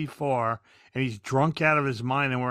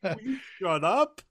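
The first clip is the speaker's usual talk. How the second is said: laughing plea; 5 semitones higher, 3 dB quieter than usual. Retold or imitated. Retold hush, laughing.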